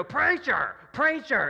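high, loud heckle from the back pew; imitated old woman's yell. Ninety-five-year-old interrupts the sermon from last pew, imitated yell. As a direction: holler it up at the pastor, imitating her. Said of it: "that's exactly how she would talk".

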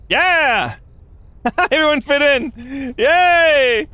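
high, loud celebration; cheering. Real, not acted. Streamer celebrating players fitting in, loud and high. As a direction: cheer like everyone just made it.

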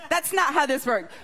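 normal talking voice

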